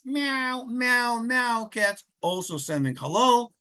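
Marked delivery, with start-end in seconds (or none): 0.0-1.6 playful high meowing for the grandkids; high, sing-song "meow" three times like a cat. Retold or imitated. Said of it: "for princesses and cats".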